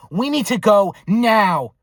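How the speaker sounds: loud, high, slow demand; forceful order. Retold or imitated. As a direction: insist firmly on leaving this instant. Slaps uncle, orders him out.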